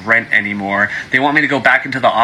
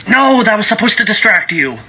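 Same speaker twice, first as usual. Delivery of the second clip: much higher put-on voice; defensive, caught in a trick; caught-out official; 10 semitones higher than usual.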